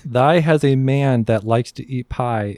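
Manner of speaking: ordinary talk, nothing marked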